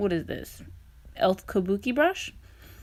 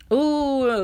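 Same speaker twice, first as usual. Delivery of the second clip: excited ooh; 6 semitones higher, 5 dB louder than usual. Real, not acted. Admiring finished brows.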